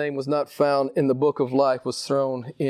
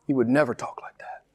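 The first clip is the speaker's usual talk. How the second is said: hushed rebuke; 2 semitones lower, 6 dB quieter than usual. Real, not acted. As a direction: drop right down in pitch and volume, gravely. Same rebuke repeated low and hushed.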